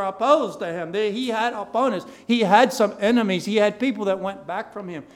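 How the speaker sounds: loud, emphatic sermon delivery; forceful preaching. Real, not acted. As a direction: drive it home to the congregation, building in force. Preacher building force on opponents.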